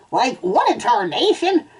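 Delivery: high-pitched startled cartoon outburst; startled reaction. A narrator's reading. Cartoon owner reacts to his dog, high and startled. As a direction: react with a high jolt of surprise. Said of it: "the man reacted".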